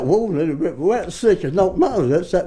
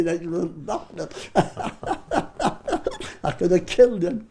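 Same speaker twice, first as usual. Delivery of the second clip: ordinary talk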